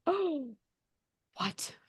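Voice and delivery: hushed, halting disbelief; imagine being struck speechless, softly; soft mock shock with a gap; stunned speechlessness. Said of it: "speechless. I would have been like".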